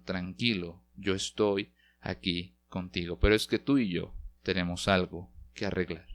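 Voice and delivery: tender Jesus. Acted. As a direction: hush a frightened friend. Jesus reassures anxious believer.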